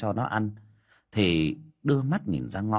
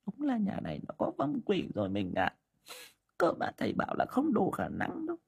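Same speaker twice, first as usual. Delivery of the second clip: sorrowful; 11 semitones higher, 6 dB quieter than usual. Acted. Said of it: "buồn rầu đáp".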